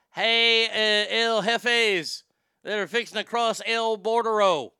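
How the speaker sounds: loud hammed-up call to the border crossers; hollering at them across the border, half joking; mock shouted address. Comedian shouting out to migrants at the border, hammed up.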